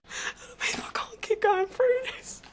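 whispered sob into tearful words; can't hold the tears back; breaking down crying. Acted. Mother at the end of her rope, crying and broken.

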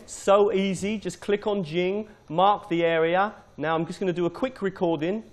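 ordinary talk, nothing marked

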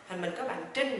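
ordinary delivery

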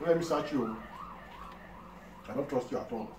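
normal talking voice

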